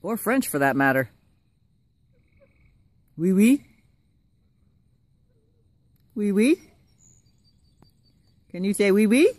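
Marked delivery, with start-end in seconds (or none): 3.2-9.3 high, lilting pet talk with rising ends; high sing-song coaxing. Real, not acted. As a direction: prompt the animal to repeat it. Coaxing pet to speak French.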